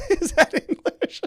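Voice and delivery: high question breaking into laughter; cracking up while talking; laughing. Real, not acted. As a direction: ask it through real laughter. Cracks up at own accent.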